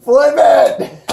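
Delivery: loud, high shout; excited yell. Real, not acted. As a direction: yell it at the cards. Yelling at card flip.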